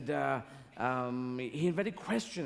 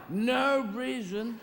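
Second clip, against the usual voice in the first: high, slow, flat-out no reason; mock blunt audience answer; 7 semitones higher, much slower than usual. Audience to comedian, slow mock careless reply. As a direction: drag out a careless shrug.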